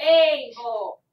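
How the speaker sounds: "able" with a loud, drawn-out first vowel, rhyming with day; long A. Shown as a demonstration. Teacher showing the macron sound, "able" with loud long A. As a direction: hit the long A at the start of "able", then drop for "bul". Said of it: "coding the A with a macron because it says".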